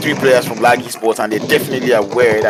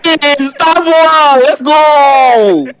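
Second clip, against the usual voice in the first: high, loud, slow cheer; shocked hype; 8 dB louder, much slower than usual. Real, not acted. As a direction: cheer it out in disbelief. Hype after a clutch play.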